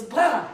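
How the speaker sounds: loud "Blah" with a back-of-tongue R; imitating a child's French R. Coach imitates a little girl from the clip, loud. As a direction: echo the little girl's R sound, loud. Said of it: "when she goes".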